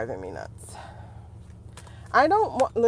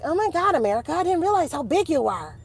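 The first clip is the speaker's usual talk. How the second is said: higher-pitched mock astonishment; imitated amazement; 7 semitones higher than usual. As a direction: play amazed outsiders having a realisation. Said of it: "They're all like".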